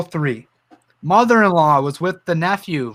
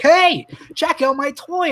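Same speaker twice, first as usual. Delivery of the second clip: put-on excited little kid; 10 semitones higher, slower than usual.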